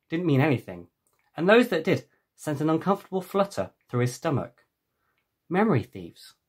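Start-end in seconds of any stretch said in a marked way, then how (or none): none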